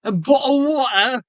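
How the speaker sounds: high, rising British "bottle of water"; mock British accent. Echoing his friend's line in a mock British accent. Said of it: "British people".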